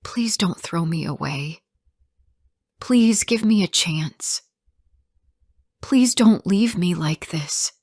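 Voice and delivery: a mildly pleading voice; understated plea, rising as it goes; begging. A narrator's reading. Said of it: "I begged".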